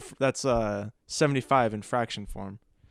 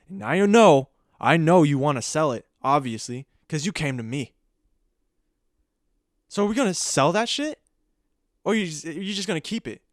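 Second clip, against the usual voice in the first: mock angry pawnbroker; 3 semitones higher, 5 dB louder than usual. Pawnbroker berates seller, heated.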